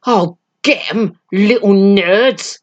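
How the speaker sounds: a bully lunging to grab; drawn-out sneering menace; menacing thug. Acted.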